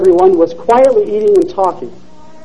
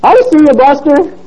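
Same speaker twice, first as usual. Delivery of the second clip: yelling; 7 semitones higher than usual. A narrator's reading. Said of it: "as he yelled".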